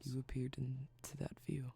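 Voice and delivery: soft, intimate, fading to a whisper; sultry close-mic voice. Acted. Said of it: "my sultry speaking voice".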